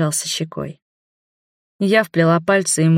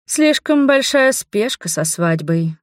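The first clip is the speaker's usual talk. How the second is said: usual speaking voice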